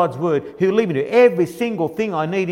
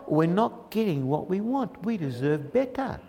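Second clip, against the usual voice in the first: soft, slow, sulky grumble; mock whinge; 11 dB quieter than usual. Ungrateful people complaining, soft sulky grumble. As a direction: sulk it under your breath.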